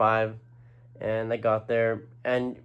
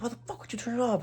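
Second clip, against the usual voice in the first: very high mock yell; imitating pissed guy; 14 semitones higher than usual. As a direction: act out his angry challenge in a high voice. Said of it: "he looked pissed".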